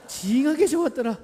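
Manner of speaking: animated Jeolla dialect demo. Shown as a demonstration. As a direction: stress 징하게 in Jeolla style. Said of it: "전라도 버전 네 가지나 됩니다".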